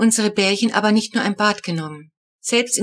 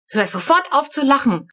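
angry snap; 6 semitones higher than usual. A narrator's reading. Papa furious at his laughing child, snapping. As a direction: bark at the laughing kid. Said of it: "schnauzt mich Papa wütend an".

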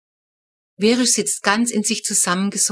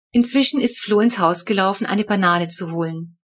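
ordinary delivery

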